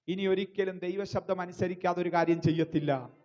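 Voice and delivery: loud, insistent sermon delivery; forceful preaching. Real, not acted. Preacher urges the congregation to renew their resolve, loud forceful preaching. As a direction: drive it home at full preaching volume.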